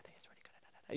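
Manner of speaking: barely audible unvoiced whisper; imitated inaudible whisper. Imitating quiet Lily answering, in a tiny whisper. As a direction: whisper it so nobody can hear. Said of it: "Lily is an inaudible character".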